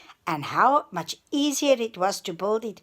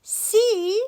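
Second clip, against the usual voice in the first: high, loud smug piggy; triumphant; 13 semitones higher, 7 dB louder than usual. Acted. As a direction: gloat proudly. Pete proud to brothers.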